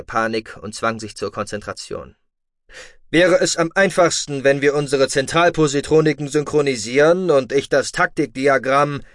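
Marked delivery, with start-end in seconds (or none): 3.1-9.0 loud Terran voice, talking on insistently; forceful Terran commander. A narrator's reading. Terran keeps proposing to link the positronics, loud and commanding. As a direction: press on loudly with a battle proposal.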